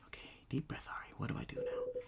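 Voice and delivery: unvoiced whispered self-talk. Ari calming herself down, whispered. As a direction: whisper it to yourself.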